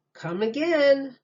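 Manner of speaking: men calling goodbye; friendly farewell. A narrator's reading. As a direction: wave them off with a hearty call. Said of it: "said some men".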